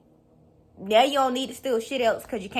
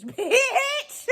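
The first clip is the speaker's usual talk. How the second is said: 1 laughing insult; 12 semitones higher than usual. Real, not acted.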